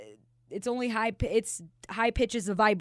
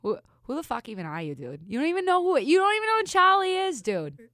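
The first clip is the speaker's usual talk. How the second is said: indignant Boston local, jumping high and loud; Boston accent; 6 semitones higher, 4 dB louder than usual. Acted. Asked to show off a Boston accent, Boston accent. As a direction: rant like an offended Boston fan. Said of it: "do your Boston accent".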